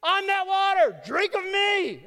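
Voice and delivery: very high, loud, drawn-out holler; hammed-up attention-grabbing yell; mock screaming out. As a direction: scream it out over a hushed crowd. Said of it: "you just scream out".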